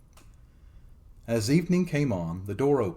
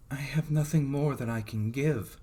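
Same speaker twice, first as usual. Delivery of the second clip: maiden's voice; 4 semitones higher than usual. A narrator's reading. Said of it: "answered the maiden".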